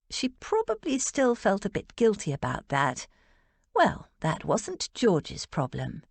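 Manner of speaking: ordinary delivery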